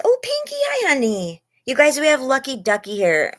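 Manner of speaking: light up as friends arrive; excited greeting. Real, not acted. Streamer happily welcoming viewers into the live.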